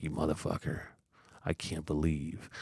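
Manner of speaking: low, quiet voice of an aggrieved pet; offended cat. Retold or imitated. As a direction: accuse softly and low, as the wronged cat.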